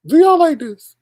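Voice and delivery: high, loud incredulous squeal; mock disbelief. Streamer asking viewers about the bad logo, high loud squeal. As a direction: throw it at chat in sarcastic disbelief. Mocking the bad design again.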